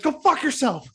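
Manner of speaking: high, loud laughing go fuck yourself; laughing mock outburst; mock yell. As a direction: snap it out half laughing. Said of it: "you're just like".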